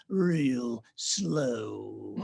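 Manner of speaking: very slow, drawn-out Southern speaker voice; slow down Zig. Shown as a demonstration. Narrator showing the son Zig's slow mode, drawn out. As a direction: stretch every word, pausing like a slow preacher. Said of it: "the slow down Zig".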